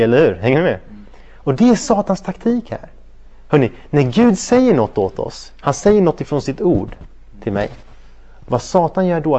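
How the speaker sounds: normal talking voice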